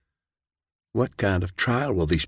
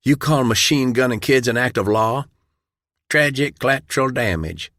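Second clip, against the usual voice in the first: plain reading voice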